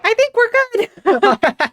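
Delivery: high, loud, comically altered voice; suddenly weird voice. Acted. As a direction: jump way up into a loud, silly put-on voice. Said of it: "Suddenly the voice changes really weird".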